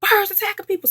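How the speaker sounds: high panicked warning; alarmed warning. Retold or imitated. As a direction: warn the stranger in panic. Warning a guy.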